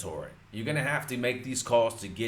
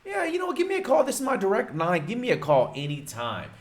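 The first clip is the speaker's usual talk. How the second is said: much higher, friendly homeowner voice; homeowner's voice; 11 semitones higher, 3 dB louder, faster than usual. Retold or imitated. Homeowner agrees to a callback, voiced high and friendly. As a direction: lightly play the eager homeowner, raised high. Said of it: "But he goes".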